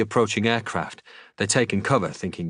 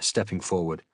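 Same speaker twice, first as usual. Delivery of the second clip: ordinary delivery, nothing marked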